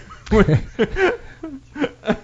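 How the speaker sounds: high, delighted burst of laughter; big laugh. Real, not acted. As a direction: crack up at your own line. Laughing at own joke.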